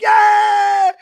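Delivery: high, loud cheering yell; gleeful yell. Retold or imitated. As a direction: whoop it up like an eleven-year-old. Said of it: "So we're like".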